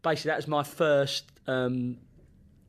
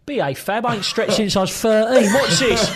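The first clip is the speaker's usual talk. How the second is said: higher, louder cocky swagger; imitating a cocky young winger, pitched up; cocky retort; 7 semitones higher, 7 dB louder than usual. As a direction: brush off the coach with bravado. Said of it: "he's gone".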